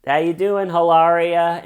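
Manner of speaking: loud, high celebrity-photographer holler; paparazzi shouting. Retold or imitated. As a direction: call out to a star like paparazzi. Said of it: "The paparazzi will be like".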